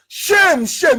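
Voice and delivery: high, loud cries of condemnation; indignant cry. Real, not acted. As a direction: hurl each word at the governors. Indignant rant at shameless governors.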